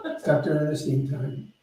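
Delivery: laughing. Real, not acted. Laughing while introducing the guest.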